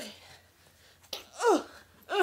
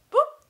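squeaky high boop; 14 semitones higher, 10 dB louder than usual. Pretend. Toy sound effect.

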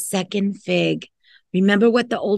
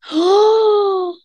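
sharp high gasp of shock; 9 semitones higher than usual. Acted. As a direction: gasp as if the fig is gone. Dog eats the fig.

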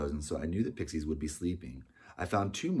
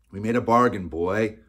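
loud, raised angry reminder; angry confrontation; 4 semitones higher, 11 dB louder than usual. Acted. Oswald pressing the boy over their bargain, angry. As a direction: come down hard on the boy.

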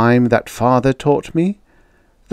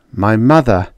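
ordinary talk

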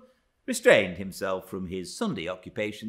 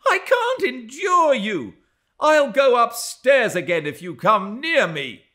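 high, loud outraged threat; shrieked, haughty disgust; disgusted cry; 11 dB louder than usual. A narrator's reading. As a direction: shout it with utter revulsion. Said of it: "she cried in a tone of disgust".

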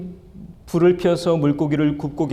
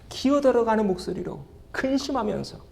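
higher, softer, shrinking voice; creeping small voice; 6 semitones higher, 6 dB quieter than usual. Shown as a demonstration. Preacher shows Peter's ashamed answer in a shrinking voice. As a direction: let the words shrink away to almost nothing.

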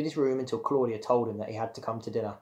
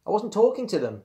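high, loud defensive denial; insisting; 9 semitones higher, 5 dB louder than usual. A narrator's reading. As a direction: insist you did no such thing. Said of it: "Bradley insisted".

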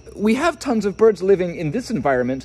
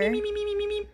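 high eager pleading; 7 dB quieter, faster than usual. Real, not acted. Begging to hold the penguin.

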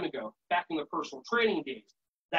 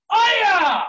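loud, high karate cry; explosive "aya" battle cry; martial arts yell; 13 semitones higher, 12 dB louder than usual. Shown as a demonstration. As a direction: let out a piercing kiai. Martial arts shout as song chorus.

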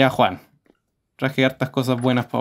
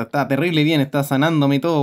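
normal talking voice

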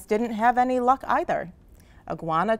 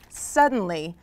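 ordinary delivery, nothing marked

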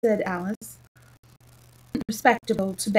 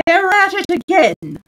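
loud, high-pitched yell; shouting; 9 semitones higher, 8 dB louder than usual. A narrator's reading. Messenger shouting the town news into the king's ear. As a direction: bellow the news out. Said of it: "shouted at the top of his voice".

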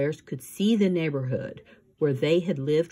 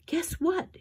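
very high, eager teasing question; bursting to share the news; excited teasing; 13 semitones higher than usual. Acted. Esme teases news.

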